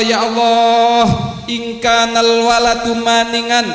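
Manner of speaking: high, pleading cries of ya Allah; tearful prayer. Acted. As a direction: beg God through mild tears. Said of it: "Samnun menangis".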